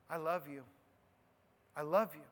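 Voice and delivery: soft, low and gentle, almost hushed; tender I love you. Retold or imitated. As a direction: say it like a blessing to someone attacking you. Answering accusers with love.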